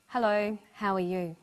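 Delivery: subdued greeting with little lift in the voice; sad. Shown as a demonstration. Teacher showing students how someone can sound sad. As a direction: sound sad saying hello. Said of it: "or sound sad".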